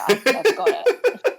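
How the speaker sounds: high, loud laughing words. Real, not acted. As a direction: laugh through it. Laughs at their sarcasm.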